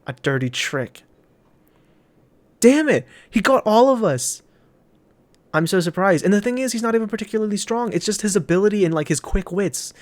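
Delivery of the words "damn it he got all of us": high, loud cry of frustration; furious high outcry; frustrated outburst. Acted. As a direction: shout it at the sky. Character realises he's beaten.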